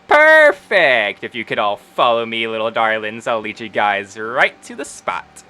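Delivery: pleased halfling Wendy. Acted. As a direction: play a gushing proprietor ushering in little darlings. Voices Wendy leading customers along.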